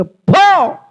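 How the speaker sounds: sharp high shout of go away; a loud, high bark of పో; shouted dismissal. Pretend. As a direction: drive the devil off with one loud word. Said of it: "ఏమనుకోవాలంటే".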